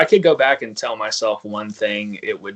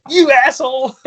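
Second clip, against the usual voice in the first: high and loud, laughing through the words; laughing insult; 7 dB louder than usual. Retold or imitated. Friend finally realizing who sent the prank, laughing insult. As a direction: call him out while cracking up. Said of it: "And she's like".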